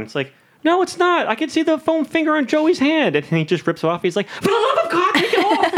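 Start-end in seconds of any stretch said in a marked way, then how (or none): 0.6-3.2 high-pitched mock indignation; exasperated retort. Acting out a Friends character arguing through a door, exasperated retort. Acts out Friends peephole argument. 4.3-5.7 very high, squealed mock outrage; exasperated plea. Acting out a fed-up Friends character for laughs, exasperated plea.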